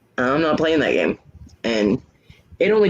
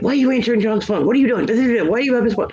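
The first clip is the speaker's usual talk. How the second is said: mock shrieking girlfriend; 9 semitones higher, faster than usual. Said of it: "they immediately started screaming".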